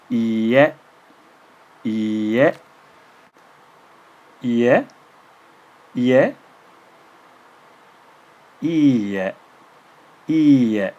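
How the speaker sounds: ie said slowly and clearly; careful model word. Shown as a demonstration. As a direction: model ie for the learner. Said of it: "Can you hear the difference?".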